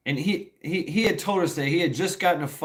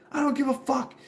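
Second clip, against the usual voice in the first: put-on much higher squeaky drunk retort; mock drunk yell; 12 semitones higher than usual. Drunk guy shouting back.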